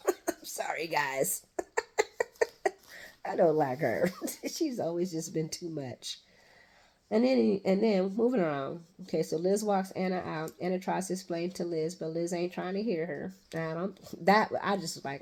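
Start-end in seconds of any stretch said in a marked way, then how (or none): none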